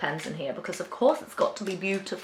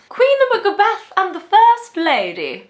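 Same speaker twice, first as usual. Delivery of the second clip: high, louder mock-regal proclamation; grand announcing; 10 semitones higher, 5 dB louder than usual. Jokingly proclaims the titles of the queen couple grandly. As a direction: announce it like royalty.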